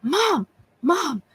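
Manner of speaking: high, urgent hushed call of mom; urgent strained hushed call; frantic half-whispered call to her mom on the porch; whisper screaming. Retold or imitated. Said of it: "I started whisper screaming at my mom".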